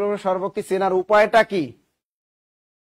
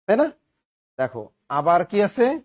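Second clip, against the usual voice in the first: ordinary talk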